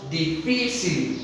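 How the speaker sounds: slow, syllables pulled apart in "difícil"; syllable-by-syllable demo. Shown as a demonstration. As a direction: spell out "difícil" slowly, dí-fi-cil. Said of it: "termina en L". ("difícil" lento, separando sílabas)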